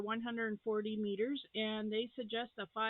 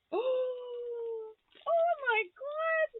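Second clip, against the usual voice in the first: higher, louder, slow, near tears of joy; overjoyed cry; 14 semitones higher, 6 dB louder, much slower than usual. Real, not acted. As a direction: let the joy crack the voice. Overwhelmed by gift.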